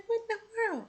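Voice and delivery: high, soft stunned whisper-like disbelief. Retold or imitated. Watching him sit beside her, high soft disbelief. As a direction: gasp it out under your breath. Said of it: "And I'm just like".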